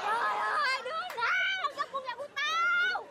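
high, drawn-out theatrical wail; wailing accusation. Acted. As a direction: cry out slowly to whoever stole it. Wailing at the unknown thief. (kêu than the thé, kéo dài)

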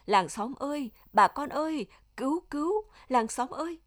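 high, urgent call to the neighbours; crying for help. A narrator's reading. Old mother summons the villagers from the pit, crying out. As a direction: raise the pitch and cry out for rescue. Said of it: "Bà giàu la lên".

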